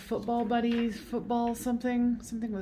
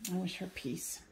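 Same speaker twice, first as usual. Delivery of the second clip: soft blessing; 8 dB quieter than usual. Real, not acted. Blessing the departed spirit.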